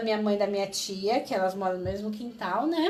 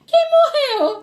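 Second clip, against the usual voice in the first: alarmed; 9 dB louder, slower than usual. Retold or imitated. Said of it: "ele olhou".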